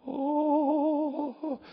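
high, eerie Twilight Zone oh; creepy otherworldly oh; spooky voice. Retold or imitated. Pastor mocking a strange man, eerie oh. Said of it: "Good voice, right?".

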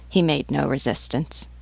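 plain reading voice

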